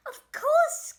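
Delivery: high, loud exclamation; crying out. A narrator's reading. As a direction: cry it out gleefully. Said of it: "cried Roy".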